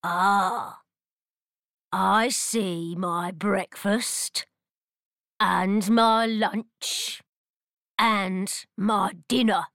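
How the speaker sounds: slow, hungry troll voice; greedy troll. Acted. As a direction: savour each meal like a greedy troll. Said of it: "The troll says".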